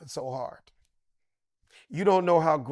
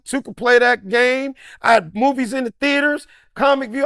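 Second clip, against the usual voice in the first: loud, high, hyped-up bragging; excited self-boasting; 7 semitones higher, 10 dB louder than usual. Retold or imitated. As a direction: brag it up, voice climbing high. Excited self-quote about fame.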